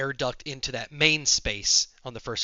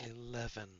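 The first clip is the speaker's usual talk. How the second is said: very soft, lower echo; hushed; 4 semitones lower, 17 dB quieter than usual. Acted. Game master repeating the number, hushed. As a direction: say it low, barely audible. Same word called loudly, then softly.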